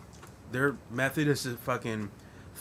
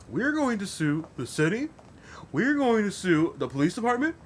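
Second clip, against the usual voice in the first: high, ramped-up sleazy lawyer rant; excited lawyer; 8 semitones higher than usual. Retold or imitated. Mocking a cartoon lawyer promising to sue everyone. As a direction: hype it up like a TV lawyer promising lawsuits. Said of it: "starts going off".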